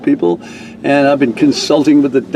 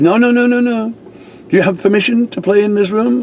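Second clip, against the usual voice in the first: much higher, fussy mock official voice; officious woman; 10 semitones higher than usual. Activities lady refusing the reverend, high and fussy. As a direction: shut it down like a prim rule-keeper. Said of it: "She said".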